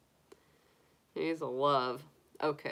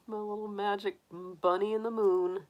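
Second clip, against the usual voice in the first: ordinary talk, nothing marked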